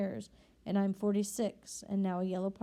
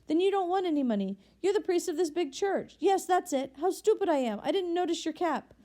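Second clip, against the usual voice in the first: astonished convict; 8 semitones higher than usual. A narrator's reading. Convict realizing his host is a priest, high astonished voice. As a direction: catch yourself, amazed and embarrassed.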